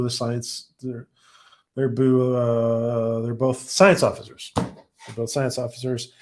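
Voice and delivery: normal talking voice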